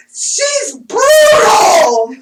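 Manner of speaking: high, loud, slow exclamation; drawn-out loud laughing shout; delighted shout. Retold or imitated. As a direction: belt it out with glee. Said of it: "I was like".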